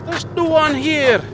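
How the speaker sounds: high, urgent shout of a name; calling out. Acted. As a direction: yell across the dark. Calling out to MacArthur.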